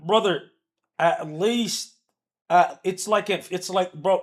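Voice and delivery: loud frustrated rant; exasperated outburst. Real, not acted. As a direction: blurt it out, fed up and loud. Ranting about missed shots.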